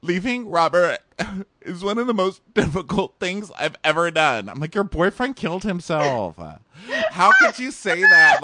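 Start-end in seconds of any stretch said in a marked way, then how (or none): 0.0-4.5 high, whiny fake weeping; hammed-up tearful confession, pitched way up; mock frown-smile crying. Contestant crying in his exit interview, mock weepy. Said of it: "he starts frown smile crying". 4.7-8.4 high, loud incredulous retort; mock appalled. Host mortified at contestant's remark, appalled.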